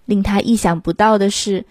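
ordinary delivery, nothing marked

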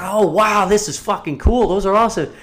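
high, loud mock amazement; gushing, imitated awe; awed. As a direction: retell it as a wowed onlooker. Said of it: "in awe of these things".

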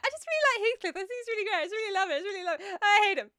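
high, gushing mock infatuation; hammed-up lovesick whine; Isabella. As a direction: gush fast and high, then flip to a wail. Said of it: "Isabella, like".

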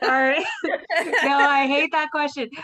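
giggling through the words; laughing while answering. Real, not acted. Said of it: "you, like, started laughing at me".

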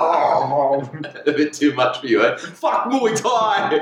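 slow, drawled Aussie voice; mock Ocker accent. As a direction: ham up a thick Aussie accent. Mimicking cafe guy then film line.

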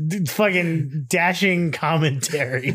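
teasing words broken up by laughing; real laughter. Real, not acted. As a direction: try to get the jab out through laughter. Laughing while teasing co-host's brief reply.

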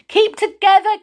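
high, loud call. Acted. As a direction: call out over the wind. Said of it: "called Moonface".

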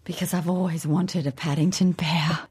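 breathy whispered aside. Real, not acted. Confessing her Paddington wish, whispered. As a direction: drop to a secret whisper.

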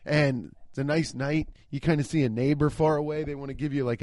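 ordinary talk, nothing marked

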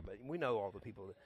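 ordinary delivery

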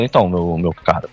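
low, calm doctor voice; dentist voice. Retold or imitated. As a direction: speak down to him like the dentist. Dentist addressing patient, quoted.

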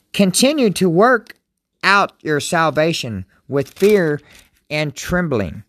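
scripture reading. Acted. Pastor reading Philippians to his viewers, solemn and slow. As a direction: read each phrase as holy words.

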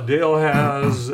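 normal talking voice

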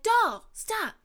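high, loud scolding call. Pretend. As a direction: call a child to stop. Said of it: "I'm like".

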